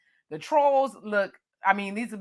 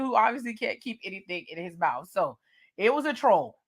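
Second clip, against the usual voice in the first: usual speaking voice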